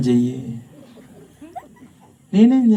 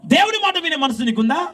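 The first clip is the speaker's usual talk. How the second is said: high, raised preaching voice; 9 semitones higher than usual. Acted. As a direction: preach it out to the congregation. (ఉద్రేకంగా ప్రసంగం)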